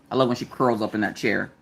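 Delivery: ordinary delivery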